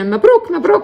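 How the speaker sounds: high, loud imitated cheering; excited congratulations. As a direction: act out women rushing up to congratulate. Acting out women congratulating her.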